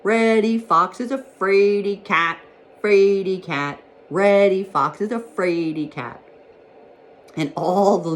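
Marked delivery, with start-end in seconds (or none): 0.0-6.2 loud, slow singsong jeer; raised, ringing call after someone running off; crying out a taunt. A narrator's reading. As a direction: chant it like a playground tease. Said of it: "he cried out after Reddy Fox".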